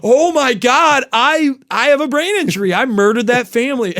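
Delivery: high, loud panicked realization; acted meltdown, pitched well up; freaking out. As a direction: cry it out in dawning horror. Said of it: "I started freaking the fuck out".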